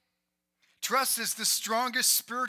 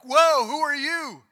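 louder, higher, slow startled whoa; 5 semitones higher, 10 dB louder than usual. Retold or imitated. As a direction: act spooked by a stranger appearing. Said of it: "they're like".

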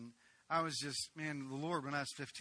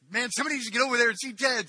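urgent; 9 semitones higher, 14 dB louder, much faster than usual. Retold or imitated. Leaders urgently calling for help.

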